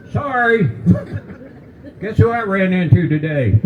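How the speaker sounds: put-on high, cheery old driver voice. Old driver apologizing after a bumper-car crash, high and chirpy. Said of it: "It's like".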